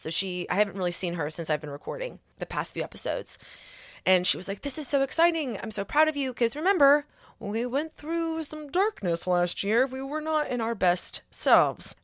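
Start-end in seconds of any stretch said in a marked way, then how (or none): 4.6-12.0 higher voice, slowing toward the end; retold proud excitement. Therapist proud of client after a dark year, excited. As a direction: imitate a proud, excited supporter.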